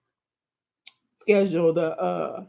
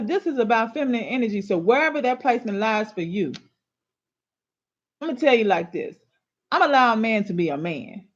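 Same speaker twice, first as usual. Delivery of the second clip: ordinary talk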